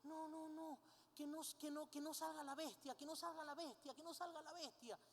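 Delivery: lightly voiced girl pleading over and over; pleading little girl. Girl begging as papá transforms, softly pleading. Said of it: "viene la niña en ese momento y dice".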